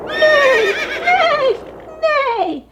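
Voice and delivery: high whinnying neighs; bellowing horse. A narrator's reading. Horse bellows at the wind in the barn, high neighing. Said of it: "Horse bellowed".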